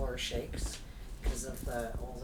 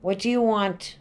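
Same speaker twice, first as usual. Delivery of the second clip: loud, higher demand to a spirit; calling out to a ghost; 6 semitones higher, 11 dB louder than usual. Real, not acted. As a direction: call out firmly into the room. Questioning a spirit.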